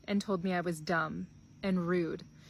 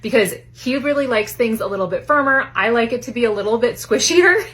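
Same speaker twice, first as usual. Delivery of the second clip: loud, bright sponsor read; much louder upbeat mattress ad; 2 semitones higher, 14 dB louder than usual. Acted. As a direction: sell it like a peppy ad host. Sponsor read at high energy.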